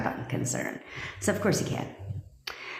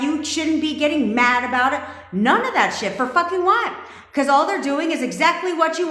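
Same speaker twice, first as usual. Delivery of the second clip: heated rant; 8 semitones higher, 3 dB louder than usual. Real, not acted. Rant building to peak.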